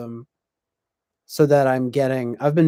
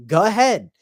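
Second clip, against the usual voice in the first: mom's Boston voice; 5 semitones higher, 6 dB louder than usual. Retold or imitated. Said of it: "she would always say it in this voice, very Boston voice".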